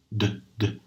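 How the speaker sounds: "D, D" said with buzzing vocal cords; voiced "D" demo. Shown as a demonstration. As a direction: voice the "D" so the throat vibrates. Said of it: "Vibra, é sonoro".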